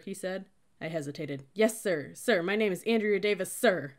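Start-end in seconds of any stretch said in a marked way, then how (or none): 1.5-3.9 crisp military reply at volume; loud recruit's answer. A narrator's reading. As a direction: sound off to a superior. Recruit answering cadet loudly.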